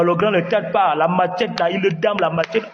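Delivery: usual speaking voice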